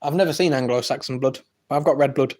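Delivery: ordinary talk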